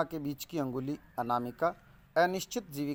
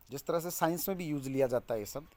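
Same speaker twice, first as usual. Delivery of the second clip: ordinary talk, nothing marked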